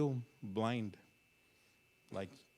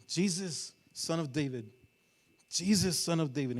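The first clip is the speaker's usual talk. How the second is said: raised, moderately loud call; imitated yelling; 5 semitones higher, 6 dB louder than usual. Bartimaeus calling as Jesus keeps walking, restrained yell. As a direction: call out, holding back from a full shout. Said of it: "knowing that he's yelling".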